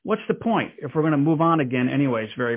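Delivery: ordinary delivery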